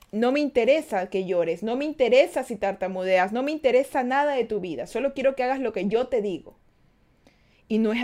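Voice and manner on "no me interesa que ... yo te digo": ordinary delivery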